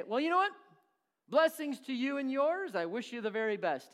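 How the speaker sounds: hammed-up insincere blessing; fake cheerful blessing. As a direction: sweetly brush off a starving neighbor. Fake blessing before slamming door.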